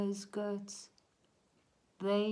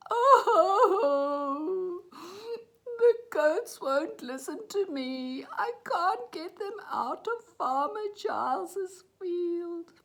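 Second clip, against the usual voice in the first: high, loud storybook wail; acted crying; 11 semitones higher, 9 dB louder, slower than usual. A narrator's reading. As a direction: wail like a thwarted little boy. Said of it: "he started crying".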